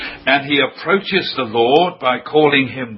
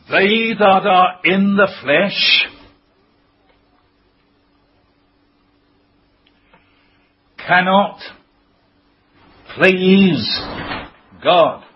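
raised, drawn-out scripture with long dramatic pauses; high, deliberate pulpit emphasis; slow, weighty quotation; 4 semitones higher, slower than usual. Real, not acted. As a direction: let each final word land alone after a pause. Preacher delivers a startling scripture statement.

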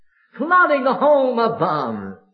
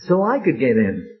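ordinary talk, nothing marked

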